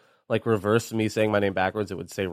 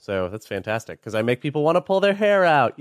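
usual speaking voice

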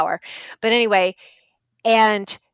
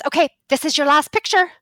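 raised, higher church-lady voice; mock yell; 8 semitones higher, 6 dB louder than usual. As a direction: announce it loudly and firmly, pitched up. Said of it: "started yelling at us".

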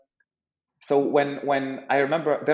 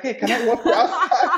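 higher, louder retold offer through laughter; laughing awkward offer; 10 semitones higher, 5 dB louder than usual. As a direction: act out the awkward offer, cracking up. Retells awkward offer while laughing.

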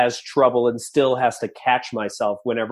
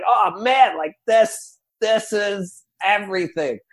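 higher-pitched, wowed, slowing on the last words; thrilled realization; 7 semitones higher, slower than usual. Retold or imitated. Recalling own reaction to the play day, thrilled. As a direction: relive being blown away, drawing out the ending.

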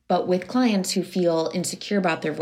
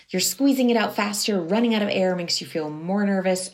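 normal talking voice

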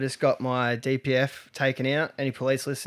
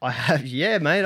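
normal talking voice

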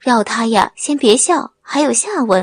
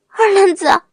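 put-on young woman's voice, higher and alarmed, crying out his name; a wife's urgent, higher-pitched cry; anxious cry; 7 semitones higher than usual. A narrator's reading. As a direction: call out to him in sudden alarm. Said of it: "王梅大急".

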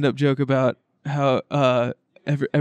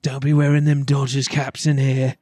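put-on British tough-guy gangster; British accent. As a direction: lay down the law in a mock British accent. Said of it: "he was doing a British accent".

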